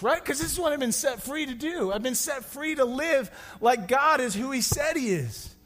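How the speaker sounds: usual speaking voice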